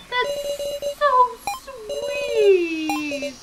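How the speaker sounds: high, very slow, drawn-out coo; gushing. Acted. Character cooing over a compliment, high and slow. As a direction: stretch it out sweetly, pitched high.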